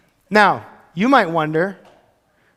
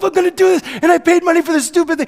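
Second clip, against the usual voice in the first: much higher, fast, strained voice; self pep talk; 10 semitones higher, 3 dB louder than usual. Retold or imitated. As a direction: pump yourself up. Psyching himself up.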